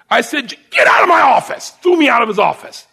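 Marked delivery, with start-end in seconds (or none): 0.7-1.7 much louder, higher order to leave; angry shout. Retold or imitated. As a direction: throw him out with your voice. Sergeant throws recruit out.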